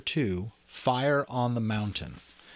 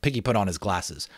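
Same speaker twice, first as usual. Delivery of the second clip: plain reading voice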